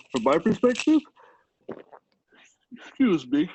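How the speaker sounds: words stretched by a yawn. Real, not acted. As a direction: talk through a big yawn. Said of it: "You, you're yawning".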